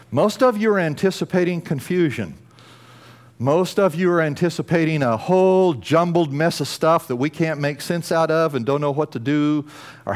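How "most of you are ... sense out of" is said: building preaching. Acted. Preacher builds the repeated claim.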